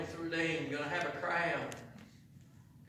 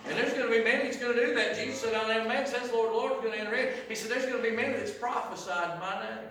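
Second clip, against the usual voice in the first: raised, higher warning preach; preaching surge; 7 semitones higher, 7 dB louder than usual. Acted. Preacher warning the congregation, raised and urgent. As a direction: press the warning home.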